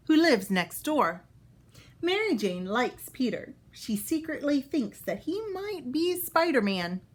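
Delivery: bright, clear storybook read-aloud; reading to children. Acted. Teacher reading a Spider-Man book to kids, storybook voice. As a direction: read it out for young listeners.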